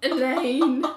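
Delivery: high, loud, mock pity; a listener teasing Elaine with mock sympathy; pitying friend. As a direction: talk to her like a sad case. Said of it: "And I'm like".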